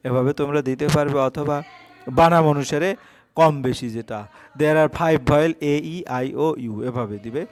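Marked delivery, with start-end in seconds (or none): 4.6-6.7 slow, word by word like dictation; dictating a question slowly; slow read-aloud. Shown as a demonstration. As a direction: read it out slowly for pupils to copy. Teacher dictates the answer slowly.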